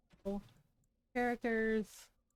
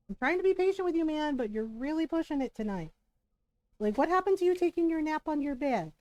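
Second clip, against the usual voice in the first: exasperated raised voice; calm turning angry; 6 semitones higher, 4 dB louder than usual. Real, not acted. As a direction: patience running out, voice raised. Losing patience with dog.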